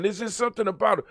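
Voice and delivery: normal talking voice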